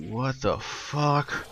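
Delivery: very slow, hushed disbelief; stunned inner thought. Retold or imitated. Thinking to himself as the manager cries, hushed bewilderment. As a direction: draw it out softly, as if only thinking it. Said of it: "in my mind, I'm like".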